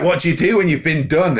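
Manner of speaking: asking the policeman in disbelief; incredulous. Retold or imitated.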